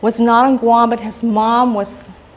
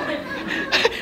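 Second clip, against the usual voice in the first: soft laugh; 13 semitones higher, 10 dB quieter than usual. Real, not acted. Laughing at the scolding memory.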